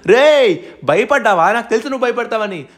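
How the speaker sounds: high, loud jeering call; loud bully's taunt; taunting shout. Acted. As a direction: yell at the enemy to come out. Taunting the snake king.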